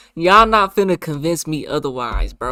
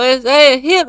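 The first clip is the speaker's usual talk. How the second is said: louder and higher, exasperated repeat; complaining loudly, insisting it hit; frustrated outburst; 10 semitones higher, 10 dB louder than usual. Real, not acted. Man complaining the payment won't show, frustrated and loud.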